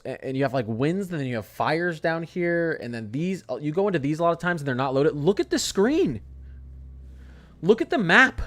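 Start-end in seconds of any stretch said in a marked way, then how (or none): 5.1-8.4 high, loud incredulous ranting; outraged at the website; shrill disbelief rant; heated rant. Real, not acted. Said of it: "Stop getting me heated".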